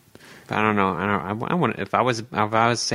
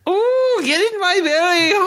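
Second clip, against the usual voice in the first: put-on very high, loud, botched Scottish accent; Fat Bastard; 5 dB louder than usual. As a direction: squeal a bad Scottish that slips into Irish. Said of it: "you're using an Irish accent".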